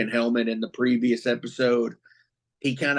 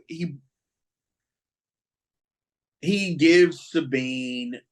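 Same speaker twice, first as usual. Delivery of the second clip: usual speaking voice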